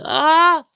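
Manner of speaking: sharp high shriek of shock; startled cry. Retold or imitated. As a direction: let out a sudden loud scream. Ginette cries out in shock. (cri aigu et fort de surprise)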